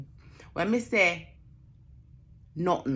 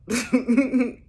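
laugh; 6 semitones higher, 6 dB louder than usual. Real, not acted. Laughing at own story.

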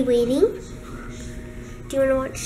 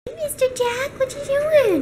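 cooing to the cat; pet talk; 10 semitones higher, 3 dB quieter than usual. Real, not acted. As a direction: coo at the cat. Talking to the cat.

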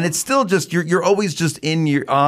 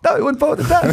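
much higher put-on voice, indignant; Larry impression; faster than usual. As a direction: go way up and protest. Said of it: "you just take on the Garland role".

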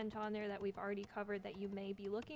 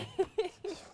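laugh; 9 semitones higher, 7 dB louder than usual. Real, not acted. Keeps laughing after the remark.

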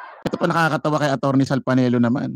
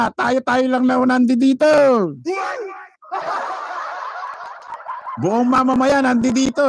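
mock rally orator; 9 semitones higher than usual. Vlogger mocking rally speakers' claims, higher mock orator voice. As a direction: ham up a rally speaker's boast. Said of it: "sabi nila".

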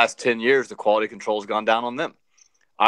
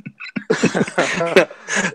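laughing fit; 7 semitones higher, 3 dB louder than usual. Real, not acted. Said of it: "I'll say we're all laughing".

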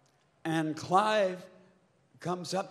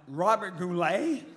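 incredulous question; 5 dB louder than usual. Retold or imitated. Singer reacting to executive's comparison, incredulous. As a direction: echo the name in mock disbelief. Acts out his offended disbelief.

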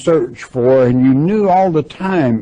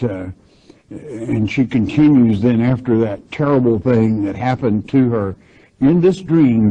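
ordinary talk, nothing marked

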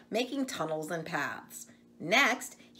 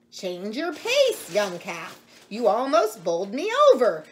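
goat shouting; 3 semitones higher, 8 dB louder than usual. Acted. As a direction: yell at the calf who nearly knocked him over. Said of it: "shouted Goat Walter".

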